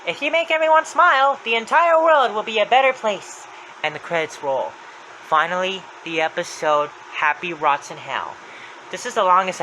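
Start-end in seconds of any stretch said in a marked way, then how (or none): 0.1-3.5 high, loud declaration; Happy's voice. Retold or imitated. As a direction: proclaim it with the pitch pushed way up. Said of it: "Happy then says".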